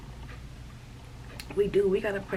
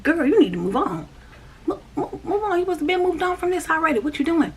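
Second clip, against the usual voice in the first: much higher, louder scolding voice; voicing God; 9 semitones higher, 4 dB louder than usual. God telling her she must move on, high and scolding. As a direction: jump up high and chide her like an impatient elder. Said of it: "God himself will come to me and be like".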